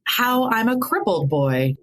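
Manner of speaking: high, slow comic delivery; joking self-mockery. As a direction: stretch it out high for the joke. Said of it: "he would make jokes".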